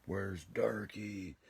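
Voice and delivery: high, loud, slow call; screamed question. Retold or imitated. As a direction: bellow the name across the room. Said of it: "he screamed as soon as he".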